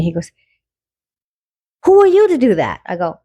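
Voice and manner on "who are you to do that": mock-angry and higher; mad. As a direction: imitate him snapping back in a raised voice. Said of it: "he started getting really mad".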